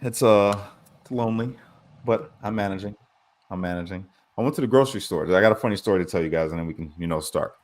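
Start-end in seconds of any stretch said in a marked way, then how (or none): 3.5-4.1 softer, trailing off to himself; softer, sadly reassuring himself; quiet, resigned repeat. Real, not acted. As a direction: say it again under your breath, a bit lonely.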